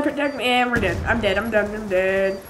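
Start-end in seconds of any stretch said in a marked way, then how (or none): none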